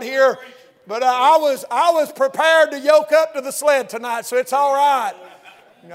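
loud, climbing preacher's cry; loud preaching climbing toward a shout; full-force preaching. Real, not acted.

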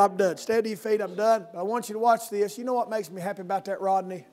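ordinary talk, nothing marked

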